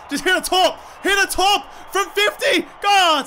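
will the ball in, then cry out at the miss; excited urging. Real, not acted. Urging a long shot.

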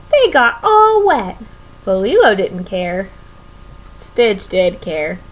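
ordinary delivery

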